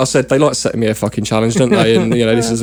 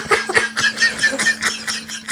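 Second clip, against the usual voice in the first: a sudden high, breathless wheeze of laughter; high wheezing laugh; 6 dB quieter than usual. Real, not acted. Laughing at his own story about the bacon sandwich, a high wheeze. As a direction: crack up, with the laugh squeaking up high.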